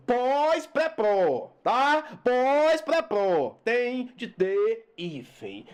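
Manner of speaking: pós-pré-pró spaced out, very slow; slow drill. Shown as a demonstration. Teacher drilling the hyphen rule, slow. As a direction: chant pós-pré-pró slowly, each prefix separate. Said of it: "rule drill".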